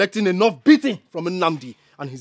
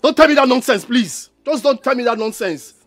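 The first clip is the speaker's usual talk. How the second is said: indignant, getting louder; raised angry voice; 6 dB louder than usual. Acted. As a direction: build from exasperation to a loud warning. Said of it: "Stop raising your voice".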